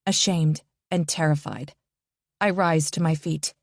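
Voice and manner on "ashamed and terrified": ordinary delivery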